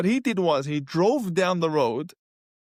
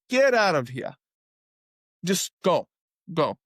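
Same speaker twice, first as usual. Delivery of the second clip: shooing off. Retold or imitated. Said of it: "shooing him off".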